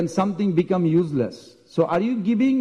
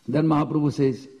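ordinary delivery, nothing marked

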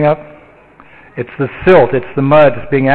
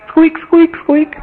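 very high tiny squeaks; mouse squeaks; 13 semitones higher, slower than usual. Acted. The great mountain gives birth, tiny high mouse squeaks. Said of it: "A little mouse".

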